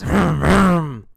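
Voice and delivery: imitated growl of revving engines. Storyteller as Alan and Tom rev their engines, imitated revving. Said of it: "They'll rev their engines".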